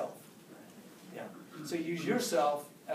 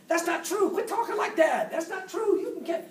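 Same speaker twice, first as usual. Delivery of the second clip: encouraging dad; 11 semitones higher, 5 dB louder than usual. Retold or imitated. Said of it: "I used to go".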